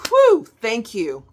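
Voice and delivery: grateful sigh of relief, then thank you; relieved thanks. Shown as a demonstration. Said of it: "stop for a minute and be like".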